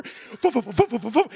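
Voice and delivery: rhythmic chopping helicopter whir; low puffed foo-foo rotor sounds; imitated helicopter noise. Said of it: "She starts doing the helicopter".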